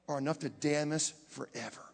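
normal talking voice

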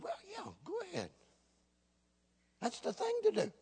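high-pitched, playful egging on; exaggerated, high mock approval; mock encouragement. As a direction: pretend to cheer on a bad plan. Mock agreement with suing plan.

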